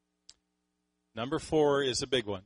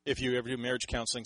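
ordinary talk, nothing marked

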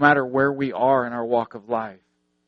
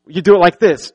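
loud, higher-pitched insistent correction; frustrated insistence; 5 semitones higher, 11 dB louder, faster than usual. Retold or imitated. Blind man instructing his guide, retold frustration. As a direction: re-enact an exasperated order, punched loud. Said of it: "he's getting frustrated".

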